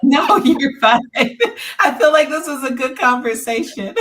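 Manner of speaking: loud, high-pitched, laughing protest; amused reassurance. Real, not acted. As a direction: reassure her through real laughter. Reassuring co-host amid shared laughter.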